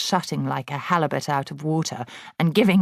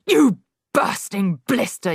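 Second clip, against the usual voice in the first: louder, higher insult; yelling; 5 semitones higher, 4 dB louder than usual. A narrator's reading. Headmistress yelling at Wilfred, loud. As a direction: yell it down at him. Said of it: "yelled the Trunchbull".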